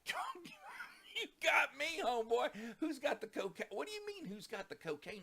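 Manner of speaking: high, loud disbelieving cry; a high, loud, half-laughing "you got me"; incredulous outburst. Retold or imitated. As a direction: squeal it out in shocked disbelief. Stunned retort to cocaine accusation.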